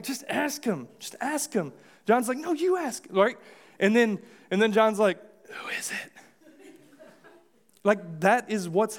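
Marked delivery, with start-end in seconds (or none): 0.0-1.7 hushed urging. Retold or imitated. Peter hushing John at dinner. 2.4-3.1 high mock protest. John pushing back at Peter. 5.5-6.1 unvoiced secretive whisper, half laughing; a disciple sneaking a question to Jesus, whispered. John whispers to Jesus at table.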